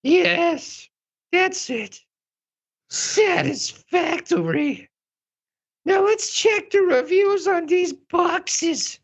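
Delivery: very high, eager drama queen. Acted. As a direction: chirp it like a busy diva. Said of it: "this is my drama queen voice".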